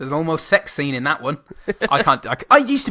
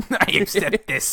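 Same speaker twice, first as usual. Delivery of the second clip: slow, laughing villain voice; hammed-up smug acceptance; mock Palpatine; 6 semitones higher than usual. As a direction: drag it out with a chuckle. Repeating Palpatine line, laughing.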